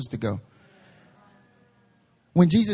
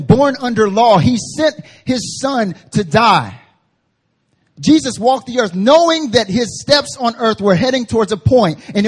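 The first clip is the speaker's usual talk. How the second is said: loud, driving sermon voice; impassioned pulpit proclamation; full-force preaching; 6 semitones higher, 8 dB louder than usual. Real, not acted. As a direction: punch each phrase out to the congregation.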